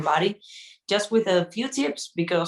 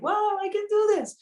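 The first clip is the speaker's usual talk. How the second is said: much higher, gushing beginner; mock amazement. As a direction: imitate a thrilled newbie. Mocking eager beginner students.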